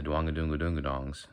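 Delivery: un-twanged Southern nonsense syllables; Southern drawl. Shown as a demonstration. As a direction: round "doonga-dongs" in a Southern accent. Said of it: "that's not country".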